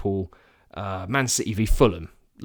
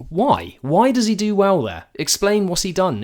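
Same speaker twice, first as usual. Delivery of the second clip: higher, louder exasperated questions; 8 semitones higher, 4 dB louder than usual. Real, not acted. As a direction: demand an explanation, fed up. Mocking the pundit, exasperated.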